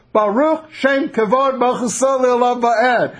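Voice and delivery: high, slow, drawn-out response; hammed-up lion reciting the response; lion answering in prayer. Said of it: "Leo the lion responses".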